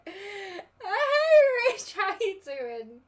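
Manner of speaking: loud, high, happy shout of hello; excited greeting. Real, not acted. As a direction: call out hello with delight. Greeting a viewer on stream.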